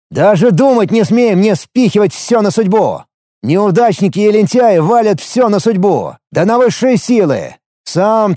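high-pitched angry tirade; explosive shout. A narrator's reading. Narrator berates the warrior for blaming fate, explosive shout. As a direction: rant at him, high and forceful, hammering each phrase. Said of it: "взорвался я криком".

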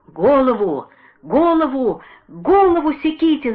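desperate high shout. A narrator's reading. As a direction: scream it out in terror. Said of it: "закричал Василий Иванович".

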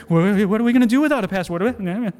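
fast, stammering fretting, pitch up; hammed-up worry tumbling out in a rush; mock panic. Batty with fear.

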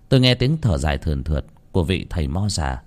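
plain reading voice

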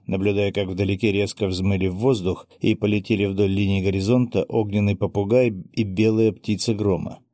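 ordinary delivery

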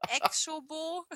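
ordinary talk

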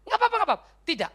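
high, loud, shocked refusals; Paul startled, refusing the poor Macedonians' gift, high and loud; startled protest. Retold or imitated. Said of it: "Waduh, Paulus kaget".

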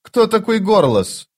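high, slow demanding question; menacing. A narrator's reading. Thief demanding answers from the girl, menacing and slow. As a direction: press her hard, suspicious. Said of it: "грозно глядя на девушку".

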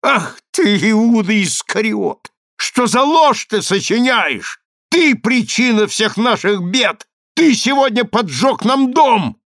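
high, drawn-out outburst of rage; frenzied accusation. A narrator's reading. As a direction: hurl accusations as if out of your mind. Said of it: "совершенно обезумев, она обратилась к Псофосу".

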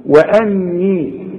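وأني drawn out, with a single ن; slow correct reading. Shown as a demonstration. Teacher repeats the correct form. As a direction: read وأني slowly, without doubling the ن. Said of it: "خد بالك من التشكيل".

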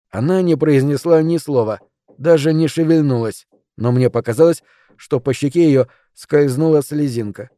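ordinary delivery

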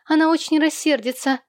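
put-on high-pitched worried child voice; little girl. A narrator's reading. Small girl anxiously warns her father.

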